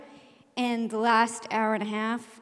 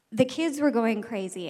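ordinary delivery

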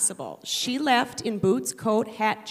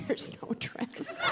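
slow, breathless whisper; whispered aside; 8 dB quieter, slower than usual. Retold or imitated. The mom admits the lie to the audience, in a slow whisper. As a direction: hiss it under your breath, slowly. Daughter hears mom's lies.

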